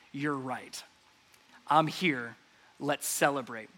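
a quiet, smiling yes; nodding, smiling Jesus. Retold or imitated. Jesus quietly agreeing with the religious leaders, softly smiling. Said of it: "he nods and smiles and says".

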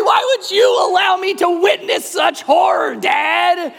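a shrill mock-outraged child yelling at his father; screaming kid. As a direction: wail at dad like a betrayed kid. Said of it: "just started screaming".

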